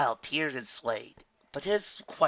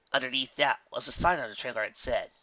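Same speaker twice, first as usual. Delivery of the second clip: ordinary delivery, nothing marked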